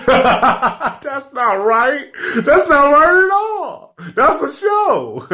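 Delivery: high, wailing mock sob with laughter breaking through; mock crying. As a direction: ham up falling apart over a TV show. Mock breakdown over TV death.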